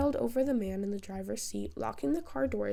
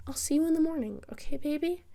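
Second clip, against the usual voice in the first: higher, louder motherly coo; tender mother; 6 semitones higher, 4 dB louder than usual. A narrator's reading. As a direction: coo it like a doting mom. Mother voiced in story.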